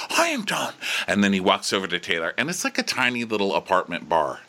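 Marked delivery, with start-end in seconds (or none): 0.1-0.7 high-pitched mock exasperation; Shep. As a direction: squeak it like a man who's had it. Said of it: "so he's like".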